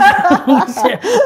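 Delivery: tawa singkat, nyaring dan tinggi; tawa lepas. Real, not acted. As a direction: tertawa pada lelucon sendiri. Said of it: "kantong kosong kali ya". (tawa geli yang spontan)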